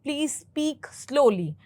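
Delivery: very slow, spelled-out please speak slowly; slow classroom example; wrong usage, said slowly. Shown as a demonstration. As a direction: say it slowly as the mistaken version. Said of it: "पर वो गलत है".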